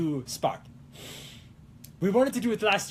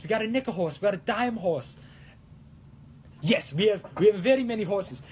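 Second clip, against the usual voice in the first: put-on Italian accent; 5 semitones higher than usual. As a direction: ham it up like a showy Italian. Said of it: "gotta keep the Italian up".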